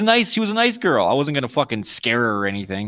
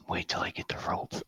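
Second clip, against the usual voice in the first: whisper; 15 dB quieter than usual. Pretend. Joking murder threat whisper.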